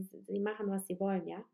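ordinary talk, nothing marked

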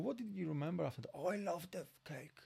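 light breathy imitation of locals' Newry accent; soft mock Newry accent, half whispered; mimicking Newry locals. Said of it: "I love your Nuri accent".

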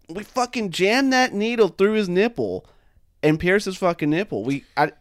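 usual speaking voice

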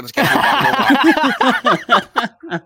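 high, slow laughing quip. Retold or imitated. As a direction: laugh through the line. Joking end of rescue quote.

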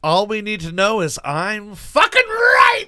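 loud gloating yell; triumphant shout. Real, not acted. Gloating over winning guess.

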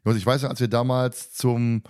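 normal talking voice

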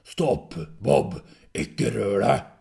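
very loud, forceful shout; shouted order. Acted. Biggest figure ordering the dog to stop, shouting. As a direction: shout a halting command. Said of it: "Den største personen ropte".

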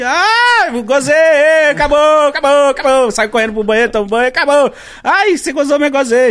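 high, loud, rushed "done, done" voice; hurried fake finish. Man acting out faking it to end sex, high and hurried. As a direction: rattle it off to get out fast.

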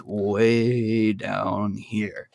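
deep, very slow low voice; dragged-out deep demo of a late-night voice. Shown as a demonstration. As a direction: drop way down low, slowly. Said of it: "my voice modulates anywhere between".

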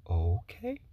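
hesitant, drawn-out okay with rising end; stunned acceptance. Retold or imitated. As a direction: retell being lost for words. Speechless teacher after student's answer.

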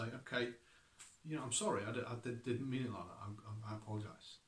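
soft, hesitant mock apology, stumbling over the words; humble apology. Preacher acting out a humble apology to someone, soft and halting. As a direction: swallow your pride and mumble it gently. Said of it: "have to swallow our pride and say".

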